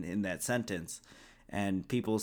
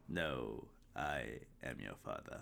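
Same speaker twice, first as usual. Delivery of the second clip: much lower, softer, deep menacing Vader voice; Darth Vader impression; 10 semitones lower, 13 dB quieter than usual. Cohost quoting the famous line as Darth Vader, low and quiet. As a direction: drop low and quiet like the masked villain. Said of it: "Vader impression".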